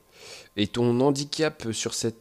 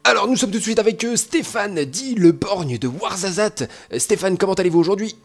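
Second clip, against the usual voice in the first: high, loud TV sports interviewer; sports commentator; 8 semitones higher, 4 dB louder than usual. Pretend. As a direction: announce it like a live sports host. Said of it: "je propose que tu parles comme un commentateur sportif".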